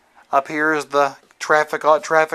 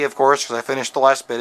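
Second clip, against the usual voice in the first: ordinary talk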